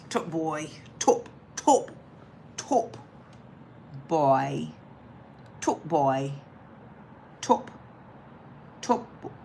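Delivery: softly trying out a London accent; quiet, halting practice in London accent; London accent attempt. Shown as a demonstration. Practising a London show title.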